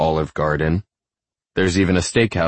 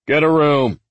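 loud, high-pitched heckle; yelling; 10 semitones higher, 6 dB louder than usual. A narrator's reading. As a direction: yell it at them from across the crowd.

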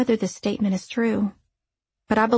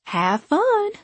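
overly eager, high and bright; too eager; 11 semitones higher than usual. A narrator's reading. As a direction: push the cheer a little too hard. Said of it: "a little too eagerly".

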